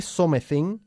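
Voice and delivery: "something" with a sounded o and e, so-meh-thing; read as spelt. Shown as a demonstration. Teacher showing the spelling, "something" read as spelt, so-meh-thing. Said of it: "Se escribe something".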